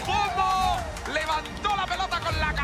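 high, excited replay description; breakneck goal commentary. Real, not acted. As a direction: keep the goal excitement going.

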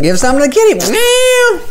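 high, loud squeak; squeaky voice. Acted. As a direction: pitch way up, like a cartoon.

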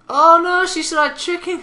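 loud, high burst of dismay; mock alarm. Commentator reacts to a pony tricking another, loud mock alarm.

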